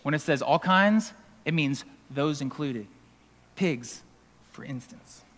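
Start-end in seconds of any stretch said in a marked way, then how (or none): 3.6-5.2 whispered aside. Real, not acted. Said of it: "whispered aside".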